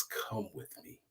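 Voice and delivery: angel's words in a soft whisper. A narrator's reading. Angel calling the sleeping man away, whispered. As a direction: breathe it like a secret.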